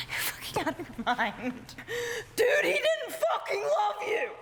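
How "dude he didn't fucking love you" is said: much higher, loud shout; outraged high shout. Acted. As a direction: yell it in disbelief. Acted outburst.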